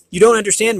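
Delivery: urgent, trying to get through; urgent explaining. A narrator's reading. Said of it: "Merrick tried to explain".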